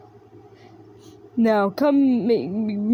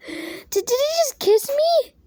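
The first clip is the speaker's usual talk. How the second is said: much higher, stammering disbelief; stunned high voice. Acted. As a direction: gasp it out stunned. Stunned after being kissed.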